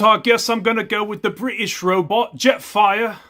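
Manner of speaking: high, loud mock-Cockney; Cockney accent. Gamer imitating the British robot, in a Cockney accent. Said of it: "That was Cockney English".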